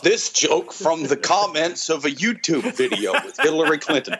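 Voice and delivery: hammed-up simple-minded comic delivery; imitating Dave. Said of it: "he talks almost like that, but it's slower and simpler".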